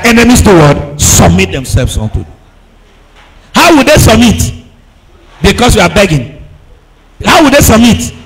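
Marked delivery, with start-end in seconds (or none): none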